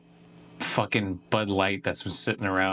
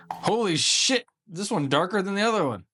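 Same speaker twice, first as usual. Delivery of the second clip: higher, fast amazed "Holy shit"; startled at how dark it is; surprised; 8 semitones higher than usual. Real, not acted. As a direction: blurt it out in genuine surprise. Reacting to dark beer.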